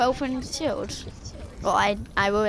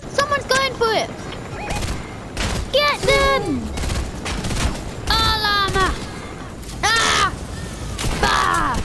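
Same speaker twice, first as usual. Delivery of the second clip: excited shouting; 10 semitones higher, slower than usual. Real, not acted. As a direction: shout urgently at teammates. Fight breaks out in game.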